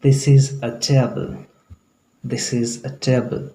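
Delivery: slow sentence with "a" as a short अ; American article pronunciation. Shown as a demonstration. Teacher models American English "a", slow and deliberate. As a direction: say "a" as a short uh, very slowly. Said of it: "अ बोला जाता है American English में".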